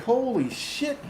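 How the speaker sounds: high, drawn-out amazement; astonished. Retold or imitated. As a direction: stretch it out slowly in high-pitched shock. Replays his astonished reaction.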